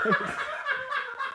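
high-pitched burst of laughter. Real, not acted. Laugh after joke.

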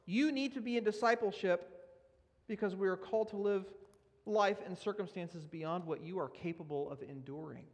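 normal talking voice

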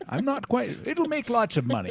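high, slow put-on Scottish voice; Sean Connery impression. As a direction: ham up a Connery voice. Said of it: "all these impressions".

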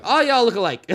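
laughing it out. Real, not acted. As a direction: say it while laughing. Joking, laughing.

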